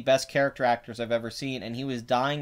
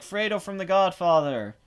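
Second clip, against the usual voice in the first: mildly mimicked fans, pitched up; imitating most people; 6 semitones higher, 4 dB louder than usual. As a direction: lightly voice everyone naming him, a bit higher. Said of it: "They're just like".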